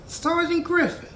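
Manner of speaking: high-pitched mock surprise; surprised recognition. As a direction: act out spotting someone long unseen. Acting out recognizing old supervisor.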